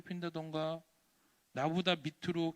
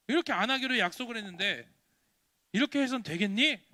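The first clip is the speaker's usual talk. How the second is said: raised, exasperated grown-up reproach; scolding adult; 3 semitones higher, 6 dB louder than usual. Acted. Adults to the boy who lied twice, exasperated scolding. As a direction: scold a child who broke a promise. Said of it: "어른들이 뭐라 그럴까요".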